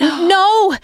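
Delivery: high desperate no; alarmed cry. Acted. Coach shouts after the wandering writer, high and desperate. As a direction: yell to stop him leaving. Coach losing his client.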